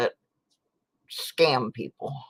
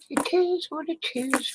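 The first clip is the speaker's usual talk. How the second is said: sing-song resignation. Real, not acted. To herself over lost pennies, sing-song resigned. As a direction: let it float up and linger, shrugging it off.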